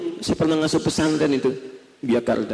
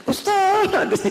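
calling out. Retold or imitated. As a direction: shout his title to get his attention. Mother calling out to ustaz.

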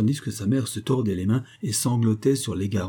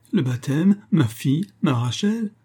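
high, louder wail of dismay; an anguished father bewailing his daughter; lamenting; 8 semitones higher, 4 dB louder than usual. A narrator's reading. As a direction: lament in rising, incredulous cries. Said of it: "se lamenta le juif".

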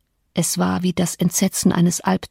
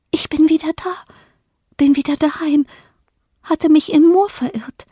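put-on high, thin voice of a lost child; ghostly child; 8 semitones higher, 4 dB louder than usual. A narrator's reading. Lost child ghost announces its return home, plaintive. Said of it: "das Gesicht eines Kindes".

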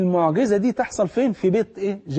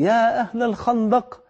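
raised, slow call across the trench; calling out to the camp; 5 semitones higher than usual. A narrator's reading. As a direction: call everyone to the meal from afar. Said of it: "واقف ومنادي". (نداء عالٍ بطيء لأهل الخندق)

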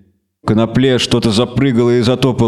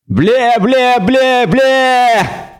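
gurgle the bleats in fury; furious gurgling; 11 semitones higher, much slower than usual. Acted. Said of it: "яростно забулькало существо".

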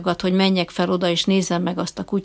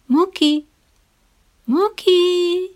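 surprisingly gentle call; 11 semitones higher, 5 dB louder, much slower than usual. A narrator's reading. Trainer gently calling his dog from under the bed. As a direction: call the dog sweetly, just short of pleading. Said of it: "meglepően szelíd, csak nem könyörgő hangon".

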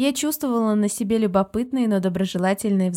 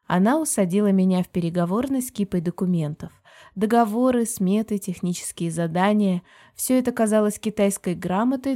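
plain reading voice

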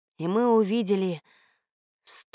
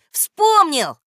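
high, loud cry of delight; joyful shout; 9 semitones higher, 10 dB louder than usual. A narrator's reading. Boy at last remembering the spell, joyful shout. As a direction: shout out in sudden triumph. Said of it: "радостно закричал Женька".

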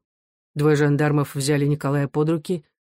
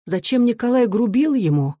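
plaintive, higher-pitched question; wistful mother, voiced rather than whispered; anguished; 6 semitones higher than usual. A narrator's reading. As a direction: lament it in a rising, troubled voice. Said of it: "мать тоскливо прошептала".

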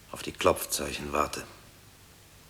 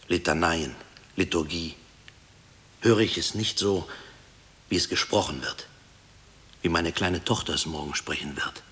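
usual speaking voice

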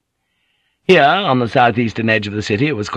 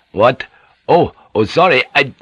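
flustered; 4 semitones higher, 5 dB louder than usual. Acted. Flustered drunk complying.

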